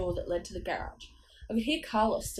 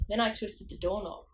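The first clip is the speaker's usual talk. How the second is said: ordinary delivery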